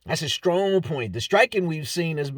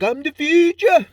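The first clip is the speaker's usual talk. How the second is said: brash imitation of a Scouse fighter; English accent; 7 semitones higher, 5 dB louder than usual. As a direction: put on an English accent as Paddy. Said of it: "I'm sorry for the accent".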